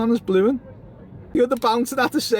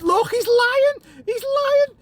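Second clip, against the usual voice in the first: high-pitched, drawn-out accusation; excited jeering; 11 semitones higher, slower than usual. Real, not acted. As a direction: call it out high, stretching the words. Excited heckling of someone shaken.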